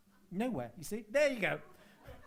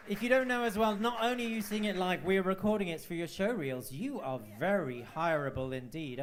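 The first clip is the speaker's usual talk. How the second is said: ordinary talk, nothing marked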